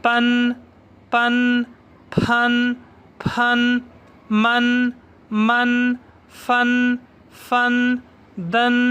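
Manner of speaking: level, isolated syllables like पन with a held final n; pronunciation drill. Shown as a demonstration. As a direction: say पन evenly on one note, holding the n. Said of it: "आखिर में है E एन".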